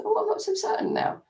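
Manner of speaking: high, wavering uncertain voice; a quavering, unsure little voice; quavery. Shown as a demonstration. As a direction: let it quaver with doubt. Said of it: "do that little bit of a quavery".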